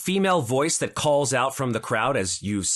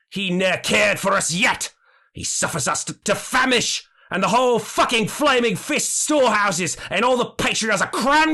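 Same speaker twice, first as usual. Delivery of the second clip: much higher, louder ranting, rising to a shout at the end; rabble-rousing redheaded man; 10 semitones higher, 6 dB louder than usual. Acted. Redheaded man stirring the crowd against the patriarchs, ranting louder into a shout. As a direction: whip the hungry crowd up against the rich. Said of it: "to be Zaj and says".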